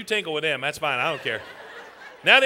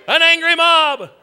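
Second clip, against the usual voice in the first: loud, high, slow announcement; big raised crowd cue; calling out cue; 7 semitones higher, 9 dB louder, slower than usual. Pretend. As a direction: stretch it out and belt it.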